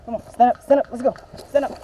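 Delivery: loud push to get up; loud urging. Real, not acted. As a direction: drive them to their feet. Medic urging downed player.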